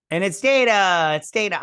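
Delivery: high, loud burst of recognition; excited reveal. Real, not acted. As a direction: announce the character with a fan's excitement. Reveals a beloved character on screen.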